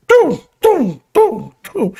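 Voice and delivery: hummed doom notes, high at first; lightly voiced mock dirge; mock funeral dirge. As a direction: sing the taps like a funeral. Playing taps, mock funeral tune.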